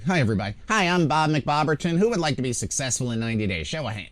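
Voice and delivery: high, loud mock seminar presenter; stage speaker. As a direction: imitate an over-eager motivational speaker, mic too close. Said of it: "right up against my throat".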